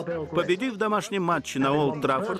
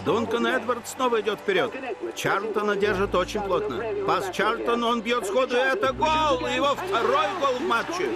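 frantic mock sports commentary; 5 semitones higher than usual. Radio match commentary over music.

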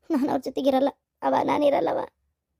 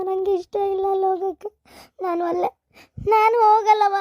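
tearful refusal; 3 semitones higher than usual. Acted. Daughter refuses to return.

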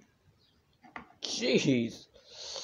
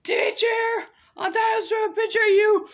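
squeaky tattling; 4 dB louder than usual. Pretend. High tattling call.

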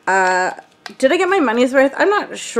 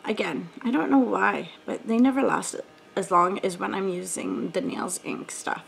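normal talking voice

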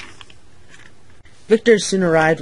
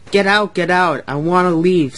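louder, pushy command; impatient order; 3 semitones higher, 6 dB louder than usual. A narrator's reading. Rude engine clearing out passengers at arrival, impatient order.

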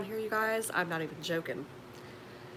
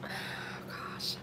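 long unvoiced sigh into a whispered gosh; heavy sigh; 13 dB quieter than usual. Real, not acted. Sighing over a friend's hard time, whispered gosh. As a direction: let it all out in a weary breath. Reacting to a friend's bad news.

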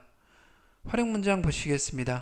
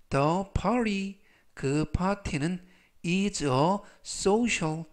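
slow reading; much slower than usual. Shown as a demonstration. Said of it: "example sentence read slowly".

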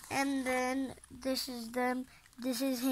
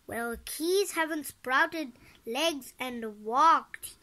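plain reading voice

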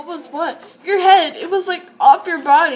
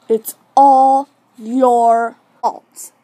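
very slow, louder, bitter accusing line; slow accusation; 6 dB louder, much slower than usual. Acted. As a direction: drag out each word to blame her. Claire accuses Destiny in drama.

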